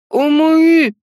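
muffled cry through a gag; gagged. Acted. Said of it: "затрепыхался ведьмак".

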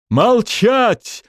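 frenzied high bark; roaring tyrant king. Acted. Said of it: "обезумев, рявкнул король".

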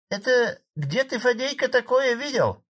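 very high put-on female voice; woman's voice. A narrator's reading. Woman heckles Fadey from back rows, high-pitched. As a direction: challenge him in a high villager's voice. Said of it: "раздался очередной женский голос с задних рядов".

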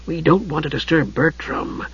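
hushed stage whisper; loud whisper. A narrator's reading. Tas warning Usha about Bertram, loudly whispered. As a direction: keep it softened yet carrying. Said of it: "he said in a loud whisper".

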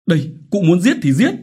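high, loud desperate outburst; desperate cry. Acted. Widow daring the chief to kill her, desperate cry. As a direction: dare him to kill you.

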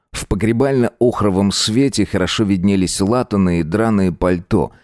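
usual speaking voice

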